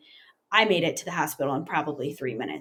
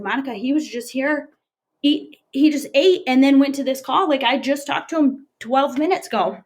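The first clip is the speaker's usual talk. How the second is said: higher, louder, insisting he was fine; retold disbelief; 6 semitones higher, 5 dB louder than usual. Wife tells the caller he was just here, disbelieving. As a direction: protest the news in disbelief. Retelling her disbelief at the news.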